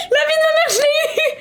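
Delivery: very high squeal through laughter; hysterical triumph. Real, not acted. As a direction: shriek your victory, half laughing. Screams in triumph after kill. (cri de victoire suraigu et hilare)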